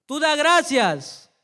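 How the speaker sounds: booming, slow and high shout; loud stern command. Retold or imitated. Sunday school teacher shouting the lesson at Nick. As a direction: thunder the order down at the boy. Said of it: "levantó la voz tan fuerte".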